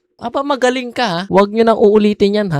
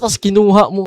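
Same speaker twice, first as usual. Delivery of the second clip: usual speaking voice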